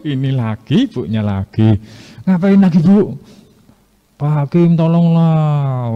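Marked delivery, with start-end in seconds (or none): 2.3-3.1 higher and louder, exasperated snap; fed up, snap at her; annoyed judge. Acted. Judge annoyed at widow again. 4.2-5.9 lightly voiced whiny plea, very slow; whining plea. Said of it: "sambil dia merengek-rengek minta tolong".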